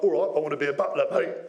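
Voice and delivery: quick, matey Cockney. Pretend. A speaker playing a Cockney job applicant, fast and matey. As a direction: fire it off like a London geezer. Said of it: "I'm all Cockney".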